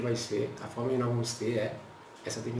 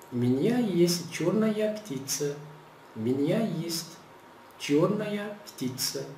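slow model phrase; 4 semitones higher, much slower than usual. Shown as a demonstration. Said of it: "Então, estou".

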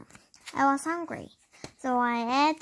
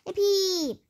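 excited cheer; 7 semitones higher than usual. Acted. Cheering at supper news.

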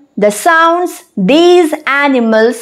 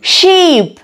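ordinary talk, nothing marked

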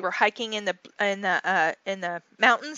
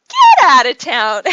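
slow, loud disbelieving cry; loud disbelief; 3 semitones higher, 15 dB louder, slower than usual. Retold or imitated. Wife reacting to news of a bear outside, slow and loud in disbelief. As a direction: stretch it out loud in amazement. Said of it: "I'm like".

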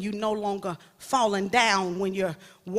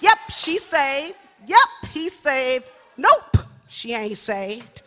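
slow, loud, know-it-all verdicts; judging who's saved; 3 semitones higher, 7 dB louder, slower than usual. Retold or imitated. New believer judging everyone in church, slow smug verdicts. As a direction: hand down each verdict smugly. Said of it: "we trying to tell everybody who's saved".

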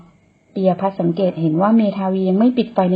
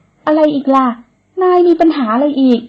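higher, louder exasperated snap; irritated retort; 7 semitones higher, 5 dB louder than usual. Acted. Woman snaps at the man who stops her, irritated. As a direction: show you're fed up with him.

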